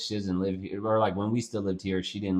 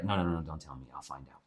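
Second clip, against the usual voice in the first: soft hushed mom; 10 semitones lower, 12 dB quieter, faster than usual. Retold or imitated. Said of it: "She'd be like".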